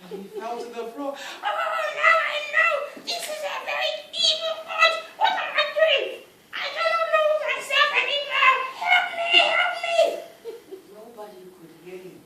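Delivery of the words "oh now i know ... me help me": high, loud frantic pleading; Oba, now a sea creature, crying for help; panicked plea. Acted. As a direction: beg in terror, voice pitched up.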